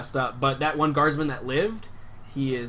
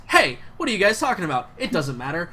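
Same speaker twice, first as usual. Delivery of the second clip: louder, chipper outburst; can't wait for more fighting; cheerful; 5 semitones higher, 7 dB louder, faster than usual. Acted. Guard interrupts, loudly cheerful.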